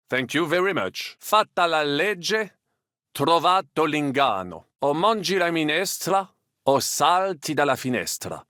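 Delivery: ordinary delivery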